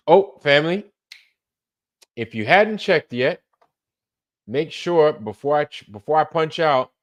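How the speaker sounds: rally the viewers, up and loud; calling out. Real, not acted. Calling the family before signing off, loud and bright.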